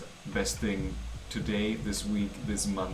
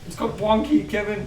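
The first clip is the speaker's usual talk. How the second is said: teasing correction; 8 semitones higher, 6 dB louder than usual. Real, not acted. Correcting a pronunciation.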